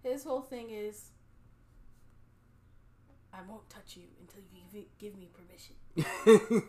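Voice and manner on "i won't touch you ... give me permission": put-on breathy Italian lover accent, like Puss in Boots. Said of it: "is that your Italian accent?".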